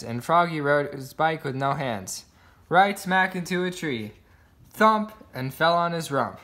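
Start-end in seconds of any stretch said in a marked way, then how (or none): none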